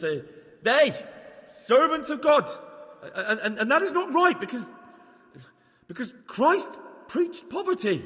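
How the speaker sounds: high, stammering righteous outrage; flustered indignation. Acted. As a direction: stumble over the words in rising indignation. Flustered indignant amateur sermon.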